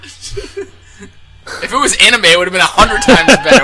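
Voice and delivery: high, laughing through words. Real, not acted. Laughing joke about anime.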